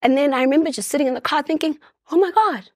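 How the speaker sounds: high, tearful rush of worry; real distress. Real, not acted. Mother alone in the car after leaving her child, distressed.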